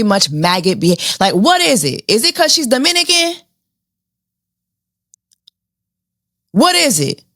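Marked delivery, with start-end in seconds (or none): 2.1-7.2 sarcastic, raised-pitch questioning; mock outrage. Real, not acted.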